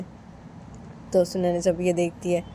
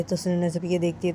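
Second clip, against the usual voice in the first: plain reading voice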